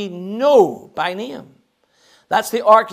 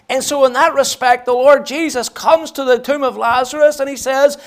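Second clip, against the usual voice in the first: higher, emphatic preaching with punched key words; impassioned preaching; 7 semitones higher, 7 dB louder than usual. Real, not acted. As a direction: preach it with rising force, driving each repeated phrase home. Preaches at full force.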